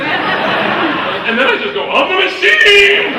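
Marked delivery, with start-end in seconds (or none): 1.9-3.1 high, roaring boast with a long last word; triumphant shout. Retold or imitated. Frat boy proclaiming himself to a Russian gangster, triumphant shout. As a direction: bellow it like a battle cry. Repeats his line to the laughing gangster.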